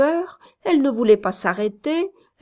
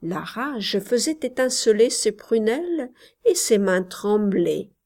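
ordinary delivery

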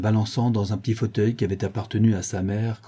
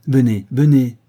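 put-on louder, brisk call to a child; insistent summons; 3 semitones higher, 8 dB louder than usual. A narrator's reading. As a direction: call her over firmly. Nursemaid calls the child over.

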